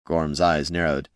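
ordinary delivery

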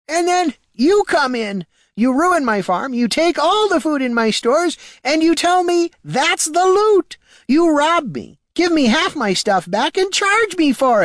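put-on old farmer near tears and furious; high, loud, tearful rage. Farmer accusing the hero of robbing him, tearful rage. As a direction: shout the grievances through tears.

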